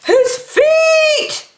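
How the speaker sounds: high, loud shriek; cartoon scream of alarm; frightened yell. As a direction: kid spots feet in the soup. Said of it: "yelled Dakota".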